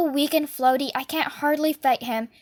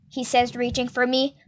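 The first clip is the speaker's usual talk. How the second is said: ordinary delivery, nothing marked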